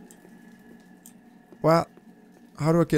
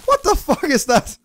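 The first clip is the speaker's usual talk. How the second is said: high, shocked outburst; startled yelp; 12 semitones higher, 4 dB louder than usual. Real, not acted. Player startled by something in the game, high shocked yelp. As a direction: yelp it in alarm, pitch shooting up.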